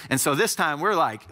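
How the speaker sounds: usual speaking voice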